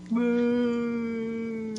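long, high mock boo; crowd booing. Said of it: "And everyone boos".

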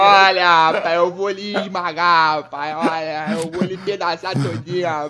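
put-on Hulk in a slow, drawled Bahia accent. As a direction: threaten slowly like a Bahian Hulk. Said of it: "O Hulk baiano".

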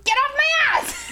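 high, slow, exasperated shout; high exasperated yell. Retold or imitated. Exasperated outburst to drunk friend.

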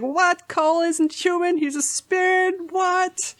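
high, stunned double-take questions; shocked disbelief. Retold or imitated. Reader reliving a book twist, high-pitched shocked disbelief. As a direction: lose your mind at the twist. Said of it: "this is where Tabby lost her mind".

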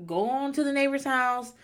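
usual speaking voice

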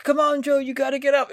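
high cheering encouragement; mock cheering. Friend parodying a typical corner cheering on Joe, high. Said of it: "rather than like".

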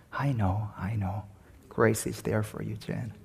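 soft, quiet and kind, fading almost to nothing; gentle reassurance. Retold or imitated. Old pastor soothing the tearful young student, soft and quiet. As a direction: comfort him with a smiling hush. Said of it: "just smiles and says".

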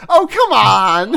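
loud, high, stretched protest; playful indignant shout; mock outrage. Real, not acted. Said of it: "giving it my all here".

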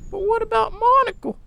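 put-on high, anxious old woman; worried old woman. A narrator's reading. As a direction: plead about the dog.

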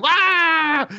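a high, hammed-up "wah" as he trips; cartoon yelp. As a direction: squeal like a clumsy cartoon villain falling. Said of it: "he'll be like".

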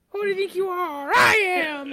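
high, loud, crying shout; imitating the bowler's scream. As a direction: belt it out like the bowler's over-the-top win. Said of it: "he's got those fucking cut off gloves".

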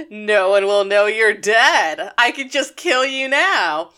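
slow, emphatic mock menace; hammed-up villainous threat; gleeful threat building. Retold murderous realization, building.

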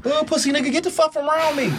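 high mocking woman's voice; shrill put-on female dismissal; woman's rude rejection.

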